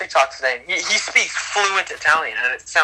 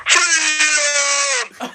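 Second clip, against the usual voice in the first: high, cracking yell of freedom; mock battle cry; 11 semitones higher than usual. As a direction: cry it out like a hero. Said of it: "yo, freedom".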